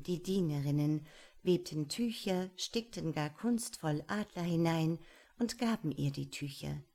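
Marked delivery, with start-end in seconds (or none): none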